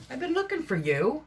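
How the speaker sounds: high, playful words to the hammer; fond scolding to object. Real, not acted. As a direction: chide the hammer like a pet. Talks fondly to the hammer.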